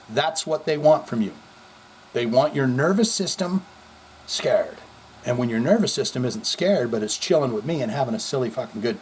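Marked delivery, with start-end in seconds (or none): none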